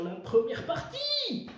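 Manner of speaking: last word climbing high and held; hyped-up announcement. Acted. Host sending viewers to part one, hyped-up announcement. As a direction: stretch the section title upward like a showman. Host hypes the first section.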